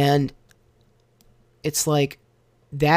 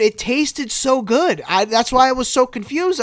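excited; 10 semitones higher, 3 dB louder than usual. Real, not acted. Exclaiming about great food.

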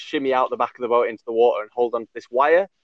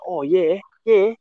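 high, keen Australian yeah; retold Australian accent, pitched up; Australian mate; 7 semitones higher, slower than usual. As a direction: imitate an eager Australian friend. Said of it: "this Australian guy, was like".

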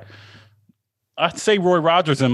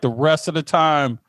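ordinary delivery

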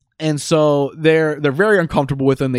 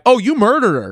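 mock accusation; 8 semitones higher, 4 dB louder than usual. Acting out the group's realisation.

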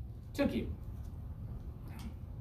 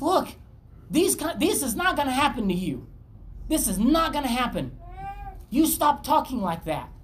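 higher pleading insistence; pleading scolding; insistent rebuke; 6 semitones higher than usual. Retold or imitated. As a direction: plead with him to stop. Peter rebuking Jesus, acted.

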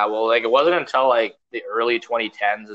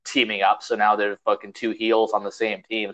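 ordinary talk, nothing marked

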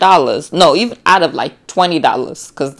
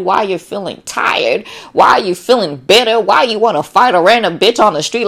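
ordinary talk

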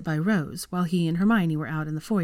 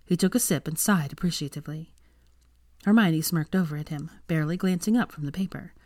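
plain reading voice

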